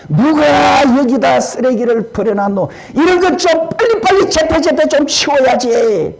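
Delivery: high, exasperated nagging; grumbling complaint. Retold or imitated. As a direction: grumble at whoever left the trash. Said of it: "발견한 사람이 투덜거립니다".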